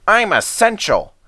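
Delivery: high, snippy van voice; spiteful brake van. Acted. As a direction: talk back as a cheeky van. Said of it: "said the van".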